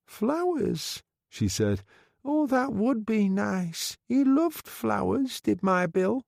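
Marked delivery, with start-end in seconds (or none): 0.1-1.0 put-on high, slow frail old lady voice; a grieving old woman, high and slow; old widow. A narrator's reading. Old widow asking the undertaker, high and slow. 2.2-6.2 put-on high, slow frail old lady voice; a grieving old woman, high and slow; old widow. A narrator's reading. Old widow asking the undertaker, high and slow.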